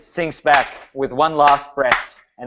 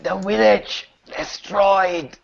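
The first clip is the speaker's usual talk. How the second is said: revived Hazel; 3 semitones higher than usual. Acted. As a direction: force out each phrase as a fading corpse. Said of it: "where is the rest of the village?".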